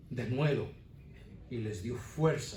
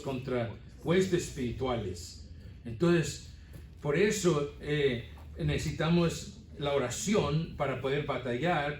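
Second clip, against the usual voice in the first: fervent sermon at full force; preaching; 3 semitones higher, 4 dB louder than usual. Acted. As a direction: drive the point home to the church.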